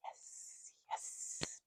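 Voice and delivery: breathy whispered yes. Real, not acted. To herself while breathing in the copal smoke, whispered. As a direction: breathe the words out unvoiced.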